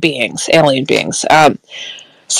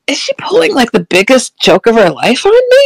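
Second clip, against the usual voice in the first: higher, re-enacted disbelief; incredulous; 6 semitones higher than usual. Woman wondering if her friend is joking, incredulous. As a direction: pitch the doubting question up high. Voicing own disbelieving thought.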